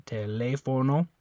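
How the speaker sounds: "teléfono" with pure O sounds; Spanish teléfono. Shown as a demonstration. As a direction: say "teléfono" with clean Spanish O's. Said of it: "this O also makes an O sound".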